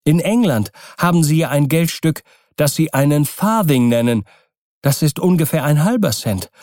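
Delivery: high, animated, insistent explaining; determined wife Kokua. Acted. Kokua tells Keawe about smaller coins, determined.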